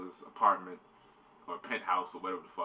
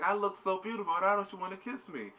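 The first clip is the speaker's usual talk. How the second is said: very high mock whine; pleading Lucy; 11 semitones higher than usual. Lucy turned down by Jack, high hammed-up pleading. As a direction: plead in a put-on, much higher voice.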